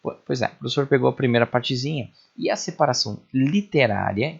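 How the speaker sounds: ordinary delivery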